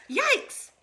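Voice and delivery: sudden high yelp of fright; startled yelp. A narrator's reading. Kids startled by a jumping rabbit, high yelp. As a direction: jump and yelp Yikes. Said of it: "they both said".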